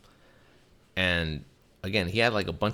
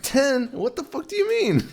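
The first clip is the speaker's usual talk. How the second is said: much higher, louder incredulous cry; shocked disbelief; 7 dB louder than usual. Retold or imitated. Narrator reacting to ten cases, high shocked cry. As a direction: squeal out the disbelief.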